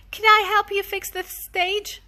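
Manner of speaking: put-on high, hopeful child voice; eager little boy. Daniel offering to help mom, high child voice. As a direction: ask like a keen small helper. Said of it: "Daniel asked".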